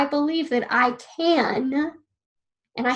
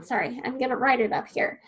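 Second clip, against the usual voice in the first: normal talking voice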